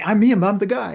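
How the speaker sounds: high, loud, eager announcement; excited reveal. Retold or imitated. Telling Gary he's the guy from the video, high and loud. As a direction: burst out that he's the one.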